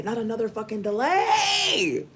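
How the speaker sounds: rising to a high, laughing wail; fed up with another delay, half laughing; exasperated, laughing. Retold or imitated. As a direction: whine it as the frustration breaks into laughter. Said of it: "'cause I'm like".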